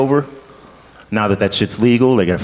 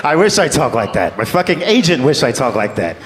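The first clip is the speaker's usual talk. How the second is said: usual speaking voice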